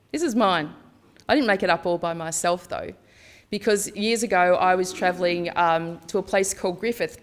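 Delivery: normal talking voice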